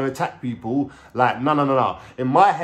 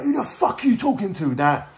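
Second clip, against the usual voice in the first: high-pitched retold angry rant; high, indignant mock tirade; lost temper; 10 semitones higher than usual. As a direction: act out losing your temper, voice jumping up. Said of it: "I just lost my temper".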